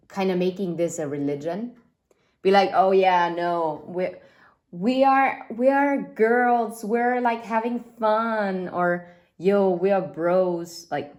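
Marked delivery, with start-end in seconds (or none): 2.7-8.7 put-on high, loud, drawn-out party girl voice; mocking airhead girls. Mocking immature friends, a high drawn-out party girl voice. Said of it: "I hope none of you people talk like this". 9.4-10.9 slow, lightly voiced dudebro; mock bro talk. Mocking immature friends, a lightly voiced slow bro. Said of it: "I hope none of you people talk like this".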